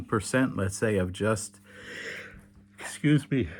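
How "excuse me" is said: yawning apology. Real, not acted. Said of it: "it's late at night here in Israel now".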